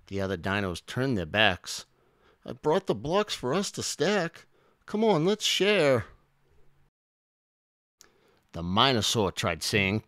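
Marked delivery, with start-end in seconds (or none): 2.5-6.1 high, eager character voice; high-pitched pleading dinosaur; coaxing plea. A narrator's reading. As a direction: coax them to play, pitched high. Minosaur pleads to join in.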